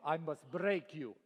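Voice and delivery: low, slow, cold Russian boxer threat; Ivan Drago Russian accent. Retold or imitated. Quoting Rocky's Russian rival to the crowd, slow low Russian accent. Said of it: "Ivan Drago".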